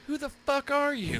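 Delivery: high-pitched retold outburst; incredulous yell. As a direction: yell it at a startled intruder. Challenging a stranger in retold story.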